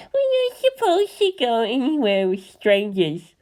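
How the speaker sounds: high, lightly voiced small boy; little boy. A narrator's reading. Little boy warns about strangers, high childlike voice. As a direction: object like a cautious kid.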